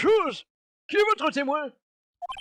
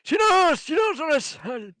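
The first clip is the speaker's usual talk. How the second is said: raised booming call for silence; loud judge shout; 6 semitones higher, 5 dB louder, slower than usual. Acted. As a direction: bang the gavel with your voice. Judge calling order in court.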